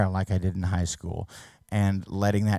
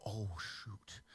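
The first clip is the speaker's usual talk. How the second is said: muttered dismay; 2 semitones lower, 21 dB quieter than usual. Retold or imitated. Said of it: "And you could see them go".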